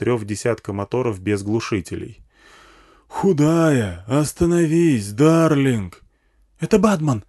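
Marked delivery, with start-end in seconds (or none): 3.1-6.0 slow, raised bullhorn plea; high, drawn-out loudhailer yell; yelling through megaphone. A narrator's reading. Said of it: "заорали сзади в хриплый матюгальник". 6.6-7.2 high-pitched cry of alarm; alarmed woman's voice, pitched up; panicked woman. A narrator's reading. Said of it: "затрясла меня за рукав женщина".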